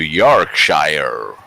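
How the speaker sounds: Yorkshire said long and drawn, the Yorkshire-accent way; Yorkshire twang. Shown as a demonstration. Streamer shows viewers the Yorkshire accent on Yorkshire. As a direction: stretch out Yorkshire in a mock Yorkshire accent. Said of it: "I know what you're referring to".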